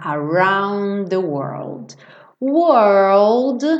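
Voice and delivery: world with a curled R then L, said slowly; teacher drills the word world. Shown as a demonstration. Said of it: "você tem que pensar nele".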